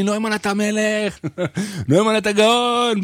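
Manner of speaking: slow, high imitation of cheering fans; crowd shouting. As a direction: play the crowd calling out his name. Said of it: "צועקים לי".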